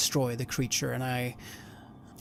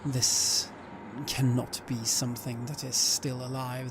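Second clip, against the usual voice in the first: normal talking voice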